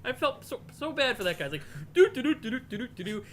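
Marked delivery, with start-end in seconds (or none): 1.9-3.2 high, loud awkward do-do-do filler; nervous demo humming. Retold or imitated. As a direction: hum like a flustered presenter stalling onstage. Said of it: "It's what I would do if I was giving a demo".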